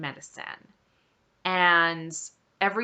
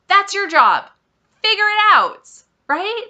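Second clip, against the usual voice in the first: much higher and louder, fed up; exasperated outburst; 12 semitones higher, 10 dB louder than usual. Real, not acted. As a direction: really let the frustration out. Venting at the doctor's question.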